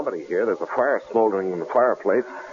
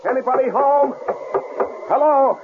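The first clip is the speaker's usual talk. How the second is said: raised calling voice, rising hello. Acted. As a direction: call out into the empty house. Calling out into empty cabin.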